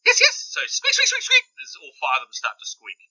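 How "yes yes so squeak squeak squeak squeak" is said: very high, loud squeaky rat voice; rats squeaking. Acted. Rats answering the word rat, squealing high. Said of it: "all the rats are like".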